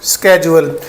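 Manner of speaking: स्केड्यूल starting with a hard sk; American schedule. Shown as a demonstration. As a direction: say स्केड्यूल as "SKE-jool". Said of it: "American English में".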